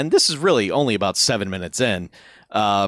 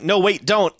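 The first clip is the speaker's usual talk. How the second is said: mock alarm; 8 semitones higher, slower than usual. Character warns another in panic.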